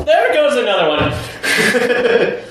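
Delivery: high, drawn-out comic exclamation; mock announcement. Telling friends another one walked out, mock announcement. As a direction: stretch it out high, close to laughing. Exasperated quip as she leaves.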